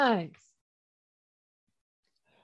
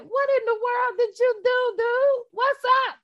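higher, mock-baffled "what in the world"; incredulous question; 8 semitones higher than usual. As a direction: toss it at Paul like a disbelieving friend. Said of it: "I was like".

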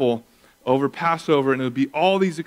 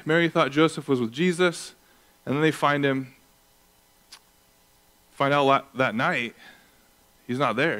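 usual speaking voice

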